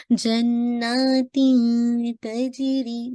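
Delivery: very slow melodic जन्नातिन तजरी; tajweed recital; Quran recitation. Shown as a demonstration. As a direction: chant जन्नातिन तजरी with light ikhfa. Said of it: "ता के मखरज के close".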